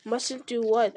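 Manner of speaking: usual speaking voice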